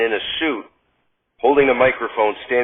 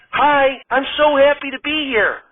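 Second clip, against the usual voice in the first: put-on much higher, chirpy, girlish voice; sweet Gilda; 12 semitones higher than usual. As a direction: gush like a delighted starlet.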